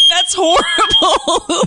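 high laughing through words. Real, not acted. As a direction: crack up while talking. Host cracking up at alarm.

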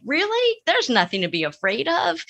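very high, incredulous mock parent; exaggerated disbelief, talking a child out of fear; dismissive parent. Therapist mimics a parent answering a scared kid, incredulously dismissive.

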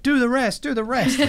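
eager fans. Retold or imitated. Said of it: "people were like".

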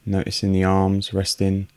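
unhurried meditation voice with long pauses; guided meditation. Real, not acted. Host guiding listeners through a breathing meditation, calm and unhurried. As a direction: guide calmly in short, evenly pitched phrases, leaving space for breath.

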